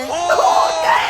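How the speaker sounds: loud, slow drawn-out okay; stunned. Real, not acted. Streamer stunned by the mother line, drawn-out and loud. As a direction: react in shock. Reacting to the a cappella verse.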